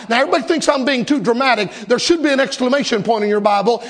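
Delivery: usual speaking voice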